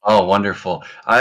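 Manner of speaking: ordinary talk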